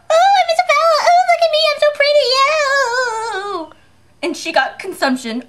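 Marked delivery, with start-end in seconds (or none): 0.1-3.7 high, mocking vain girl; hammed-up pretty daughter; prissy girl imitation. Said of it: "I had a little daughter. Isabella".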